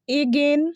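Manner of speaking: again opening on a long "ay"; wrong pronunciation. Shown as a demonstration. Teacher showing a silly mistake, wrong pronunciation. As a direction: say again as AY-gen, rising. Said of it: "बहुत सिली सा mistake".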